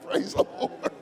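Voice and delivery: ordinary talk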